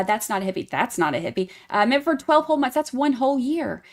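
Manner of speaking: ordinary delivery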